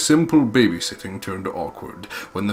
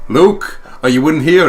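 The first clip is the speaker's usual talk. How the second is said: raised voice calling someone in the house; 7 semitones higher, 9 dB louder than usual. Retold or imitated. Father calls for Luke inside the mansion, raised call. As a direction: call out to find him. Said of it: "He's like".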